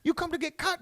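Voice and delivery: high-pitched imitation of the hairdressers; haircut ladies. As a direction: put on an eager, high lady voice. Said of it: "and they're like".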